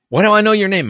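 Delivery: high, loud mock surprise; puzzled recognition. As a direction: imitate an actor excitedly trying to place a name. Acting actor's puzzled recognition.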